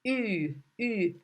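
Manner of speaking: "ü" held long with rounded lips; "ü" drawn out, ee through pursed lips. Shown as a demonstration. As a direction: demonstrate "ü" as a fronted, rounded vowel. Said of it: "pay attention that this letter".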